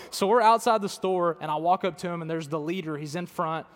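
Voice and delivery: ordinary talk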